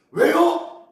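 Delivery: loud, high-pitched repeated question; impassioned preaching. Real, not acted. Preacher presses the congregation for the reason, impassioned preaching.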